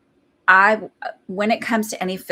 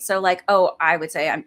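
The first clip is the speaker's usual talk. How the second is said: normal talking voice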